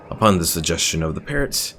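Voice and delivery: normal talking voice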